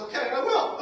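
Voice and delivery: high, laughing acceptance; cocky laugh as he says yes; laughing reply. Retold or imitated. Retold accepting the auction challenge.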